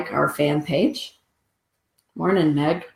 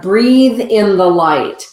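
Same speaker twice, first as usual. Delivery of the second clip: slow breath guidance; 3 semitones higher, 6 dB louder, slower than usual. Real, not acted. Guiding breath.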